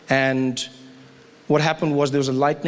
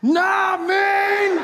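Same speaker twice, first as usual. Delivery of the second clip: epic shout. Pretend. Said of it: "relive my favorite moments".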